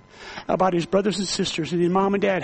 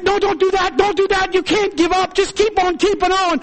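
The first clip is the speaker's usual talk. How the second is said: higher, pressing encouragement; urgent pleading; 10 semitones higher, 4 dB louder than usual. Retold or imitated. Preacher begging the despairing inmate to keep going, urgent. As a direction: urge him hard not to give up.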